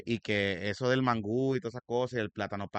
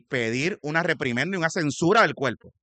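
condena enérgica, más fuerte y aguda; indignado; 4 semitones higher, 6 dB louder than usual. Real, not acted. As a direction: recalca cada acusación con indignación real. Exige censura al senador electo. (indignado, alzando la voz)

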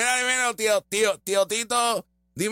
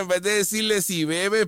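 ordinary talk

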